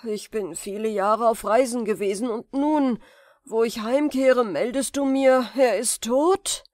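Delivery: feigned grief. Acted. The sorcerer pretends to mourn his brother, sighing. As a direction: fake a grieving uncle's sigh. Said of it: "zerdrückte eine Träne im Auge und seufzte".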